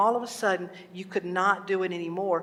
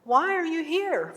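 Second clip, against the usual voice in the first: higher, insistent owl's question; 8 semitones higher, 4 dB louder than usual. Acted. Owl questioning the hunter once again he tries, higher voice. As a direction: question him from above, pitched well up. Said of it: "and the owl says".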